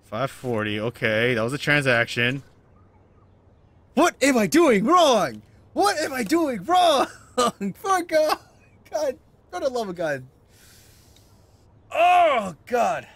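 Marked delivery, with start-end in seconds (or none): 3.9-13.1 high, loud, drawn-out outburst; angry. Real, not acted. Stuck player rails at being wrong, angry. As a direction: wail it in real frustration. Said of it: "I'm getting so angry".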